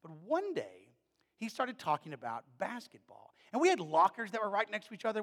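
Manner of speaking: ordinary talk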